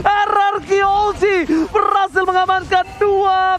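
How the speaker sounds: high, loud hype play-by-play shout; fighting game announcer. Real, not acted. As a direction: scream the kill calls like an arena announcer. Team wipe celebration call. (teriakan komentator penuh semangat)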